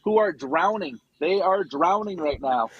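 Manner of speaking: slow insistence. Real, not acted. Insists people are drowning.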